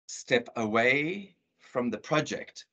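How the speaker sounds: slow, measured warning; drawn-out protective command; mock warning. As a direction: warn them off, word by word. Possessive father guarding his project.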